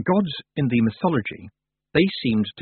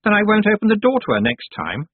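firm; 12 semitones higher, 6 dB louder than usual. A narrator's reading. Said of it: "said Anagramma firmly".

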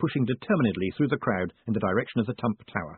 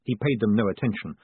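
usual speaking voice

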